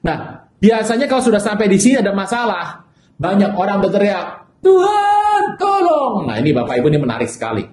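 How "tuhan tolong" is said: high, loud, pleading cry to God; desperate cry for help. Acted. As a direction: cry out as if drowning in trouble. People cry out in trouble. (berseru minta tolong dengan suara tinggi dan keras)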